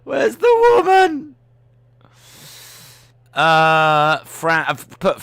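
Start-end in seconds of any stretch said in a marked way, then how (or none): 0.0-1.3 mock searching.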